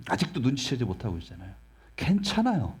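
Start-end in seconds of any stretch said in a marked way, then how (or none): 2.0-2.8 괜찮아요 sung out much higher with a falling end; high reassurance. Shown as a demonstration. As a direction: lift 괜찮아요 high, then let it fall. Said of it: "여전히 부족하도 괜찮다고요". (괜찮아요를 높게 올렸다 내리며)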